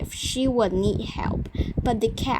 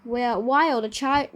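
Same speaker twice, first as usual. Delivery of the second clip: plain reading voice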